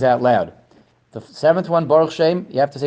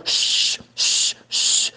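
drawn-out, repeated shushes; long hushing "shh", imitating the shusher; imitated shushing; 4 dB quieter, much slower than usual. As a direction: shush a whole congregation. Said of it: "to shushkin everybody up".

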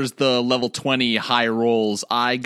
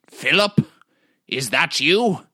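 put-on eccentric old wizard; 4 semitones higher than usual. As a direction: call out like a half-mad hermit wizard. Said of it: "I say out loud".

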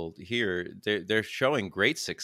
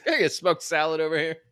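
laughing; 6 semitones higher than usual. Pretend. Mock ordering at a restaurant.

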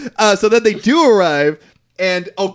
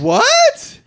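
very high, loud squawk of surprise; real disbelief; 4 dB louder than usual. Real, not acted. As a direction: blurt it out, pitch shooting up. Reacting to surprising writing credit.

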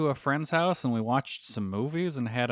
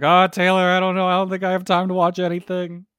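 whiny self-quote; 5 semitones higher, 8 dB louder than usual. Retold or imitated. Retelling her own excuse.

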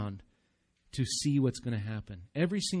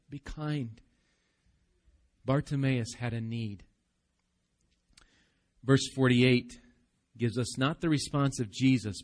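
ordinary talk, nothing marked

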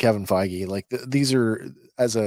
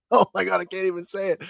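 laughing; 8 semitones higher, faster than usual. Real, not acted. Fumbles own TikTok name.

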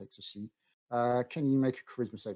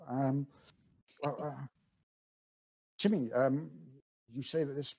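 put-on elderly gentleman; 4 semitones higher than usual. GM voices Bartold answering.

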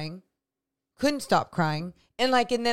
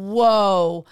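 retold alarm; 8 dB louder than usual. Retold alarm at own performance.

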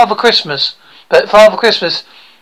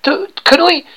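broad rural British bumpkin, higher voice; eager would-be actor; 6 semitones higher than usual. Acted. Aspiring actor boasts to coach about school play, eager bumpkin voice. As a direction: play a naive hopeful with a thick country accent.

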